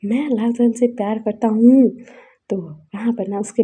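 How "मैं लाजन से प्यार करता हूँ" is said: higher and louder, an indignant outburst; retold shouting. Son shouts at his parents over the forced marriage, indignant yell. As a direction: yell the protest in the parents' faces, hurt and angry. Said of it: "चिल्ला उठता है".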